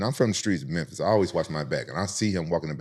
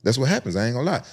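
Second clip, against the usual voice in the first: ordinary talk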